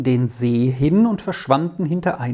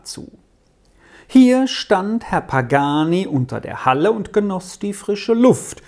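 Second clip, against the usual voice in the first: plain reading voice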